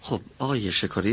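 ordinary talk, nothing marked

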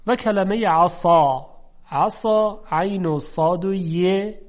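عصا with thick, emphatic ص and dark vowel; heavy emphatic s. Shown as a demonstration. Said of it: "ببینید چقدر صداش درشته".

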